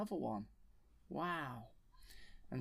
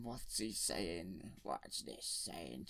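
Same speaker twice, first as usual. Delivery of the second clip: low, slow slug-creature voice; big fat alien, very slow and low; Jabba the Hutt; 6 semitones lower, 6 dB quieter, much slower than usual. Acted. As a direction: drag it out deep and slow, like a huge slimy slug. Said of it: "And Jabba the Hutt's saying".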